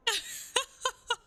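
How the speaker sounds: squealing laugh, half crying; giddy squeal after a donation; high shrieking laugh. Real, not acted.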